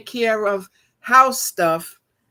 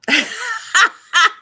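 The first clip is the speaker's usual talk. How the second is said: high, loud burst of tearful laughter; loud rueful cackle, close to crying; 6 dB louder than usual. Real, not acted. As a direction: break into a high laughing sob. Breaks up over the ruined boiler.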